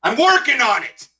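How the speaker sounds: high, loud declaration; strained motto. Shown as a demonstration. Comedian announcing a motto for twenty twenty-one, strained. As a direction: proclaim it high and loud, forcing the positivity. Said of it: "here's my motto for twenty twenty-one".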